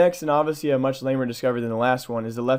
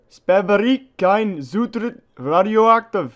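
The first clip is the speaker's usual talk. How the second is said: slow, loud, much higher hammed-up German accent; 8 semitones higher, 8 dB louder, much slower than usual. Reading a radiation sign aloud, slow loud mock German accent. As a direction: drag every word out, loud and high, in a mock German accent. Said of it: "sorry for my terrible German accent".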